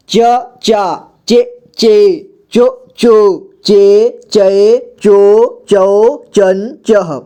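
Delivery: slow च series, each syllable apart; syllable drill. Shown as a demonstration. Teacher recites च series so learners can read, slow drill. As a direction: recite each syllable, drawing out the long vowels. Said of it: "ताकि आपको सुनकर सभी को पढ़ना आ जाए".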